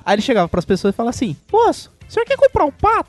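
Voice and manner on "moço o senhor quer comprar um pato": put-on child's voice, pitched way up; high kid voice. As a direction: beg like a little boy selling his duck. Boy voiced in a joke.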